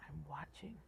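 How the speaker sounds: soft, whispered demon; hushed, eerie demonic whisper. A narrator's reading. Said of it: "some demonic voice say".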